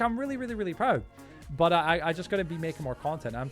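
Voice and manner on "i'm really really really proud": proud and excited. Real, not acted. Proud of channel growth.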